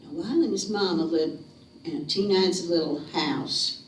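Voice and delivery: usual speaking voice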